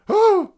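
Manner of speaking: sudden, very high and loud mock shriek; short piercing imitation of a shriek; imitated shriek. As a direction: yelp it out like a startled cartoon golem. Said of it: "He just kinda shrieks".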